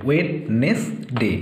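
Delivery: "Wednesday" very slowly, in three separate syllables. Shown as a demonstration. Teacher splitting the word for pupils, "Wednesday" syllable by syllable. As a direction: break "Wednesday" into wed, nes, day with clear gaps. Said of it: "তিনটে syllable ভাগ করে নেবে".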